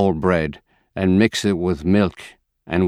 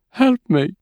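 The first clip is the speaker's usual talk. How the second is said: high, breaking cry for help; desperate plea; 14 semitones higher than usual. A narrator's reading. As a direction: beg in despair. Grieving mother begs for help.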